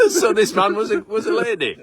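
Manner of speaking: high, loud, laughing through the words; amused and laughing hard. Real, not acted. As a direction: tease him while cracking up. Laughing at the failed accent attempt.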